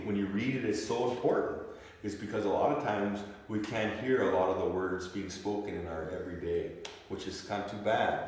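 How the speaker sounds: usual speaking voice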